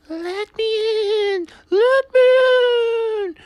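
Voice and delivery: very high, loud, slow ghost wail; spooky voice. A narrator's reading. Percy plays a ghost to scare Thomas, spooky wailing. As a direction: drag each word out like a moaning ghost. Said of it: "said Percy in a spooky voice".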